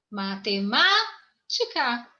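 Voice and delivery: slow "Matemática", leaning on the second MA, then a break before "tica"; stress on third syllable. Shown as a demonstration. Teacher showing the tonic syllable, "Matemática" slowly stressed on the second MA. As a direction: stretch and lift the MA in the middle of "Matemática". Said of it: "Terceira sílaba, ma".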